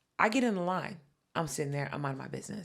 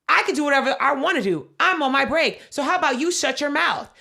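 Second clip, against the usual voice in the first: higher and louder, snapping back; fed-up clerk; 7 semitones higher, 7 dB louder than usual. Retold or imitated. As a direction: fire it back at them. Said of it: "she said".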